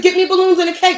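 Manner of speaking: loud, high-pitched insistence; heated protest. Real, not acted. Defending the birthday request to partner, heated protest. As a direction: raise your voice and insist on it.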